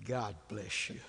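soft, low farewell blessing; calm wife. Retold or imitated. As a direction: imitate a wife calmly seeing off a grumbler. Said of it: "She just is the calmest person".

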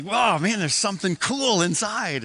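put-on high, eager little-kid thrill over a mystery box; a first grader buzzing to see what's inside; retold excitement. First grader opening his raffle prize, mildly excited. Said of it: "I'm really pumped about the box".

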